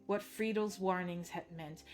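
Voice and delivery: ordinary delivery, nothing marked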